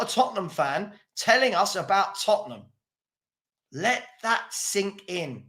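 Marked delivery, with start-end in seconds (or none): 3.7-5.4 quiet, slow emphasis. Shown as a demonstration. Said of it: "repeated for emphasis".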